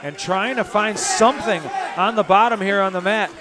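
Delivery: fast, lively fight call; play-by-play commentary. Acted. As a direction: call the action live. Live MMA play-by-play.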